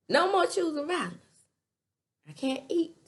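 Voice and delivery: high, loud and insistent; loud mock plea. Father pleading loudly against violence.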